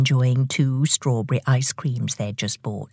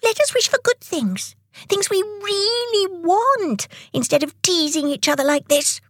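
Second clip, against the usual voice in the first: Tick, pleading. Acted. Tick asking Tock to stop teasing, pleading high voice. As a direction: reason earnestly in a very high voice.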